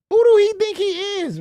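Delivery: high, loud disbelieving exclamation; incredulous outburst. Real, not acted. Host yelling in disbelief at the caller's martial-arts boast, loud. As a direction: shout it in sheer disbelief.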